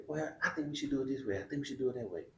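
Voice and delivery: lightly voiced inner debate; imagined back-and-forth. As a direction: talk it through like a second person. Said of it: "You don't have nobody to say".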